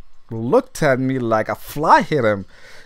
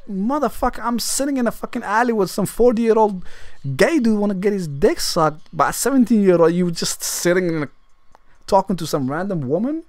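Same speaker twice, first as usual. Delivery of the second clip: higher, indignant; teen ranting at the friend, higher and indignant; mock rant; 7 semitones higher than usual. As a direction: vent at him in disbelief.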